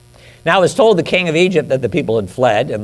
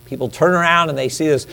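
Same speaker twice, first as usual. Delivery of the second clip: usual speaking voice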